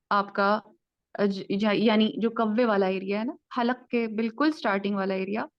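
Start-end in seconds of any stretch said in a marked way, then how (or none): none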